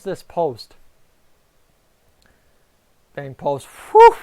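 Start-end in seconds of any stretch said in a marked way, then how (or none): 3.7-4.2 very high, very loud whew of relief; relieved whew. Real, not acted. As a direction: blow out the fright in one loud burst. Post hit, near goal.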